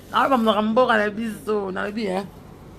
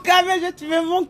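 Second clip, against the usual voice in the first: fast, laughing gush; 7 semitones higher, 3 dB louder, much faster than usual. Real, not acted. Gushing to a viewer.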